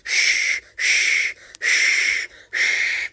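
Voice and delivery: engine letting off steam; steam hiss. Acted. Steam noise.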